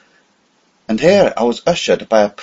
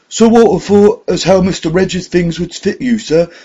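higher, louder, deferential servant; grave butler voice; 10 semitones higher, 5 dB louder than usual. A narrator's reading. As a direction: speak as a proper old butler.

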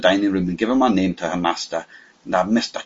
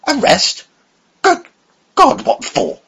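much higher, louder shocked cry; 6 dB louder, slower than usual. A narrator's reading. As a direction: cry out "Arrest?" in alarm. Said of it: "he looked really shocked".

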